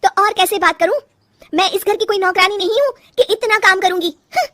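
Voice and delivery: high, loud, indignant outburst; fed-up wife answering back, ending in a huff; angry retort. Acted. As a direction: fire back at full irritation, pitched high. Wife argues with mother-in-law.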